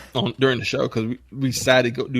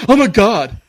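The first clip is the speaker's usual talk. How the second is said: higher, louder mock gasp; shocked young guys; 7 semitones higher, 8 dB louder than usual. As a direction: play the scandalized kid. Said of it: "they're like".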